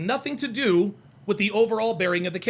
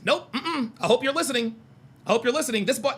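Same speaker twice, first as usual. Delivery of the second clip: usual speaking voice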